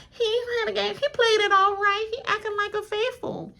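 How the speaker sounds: high imitation of Arthur; mock Arthur's voice, high and pleased; imitating Arthur. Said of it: "Arthur gonna be like".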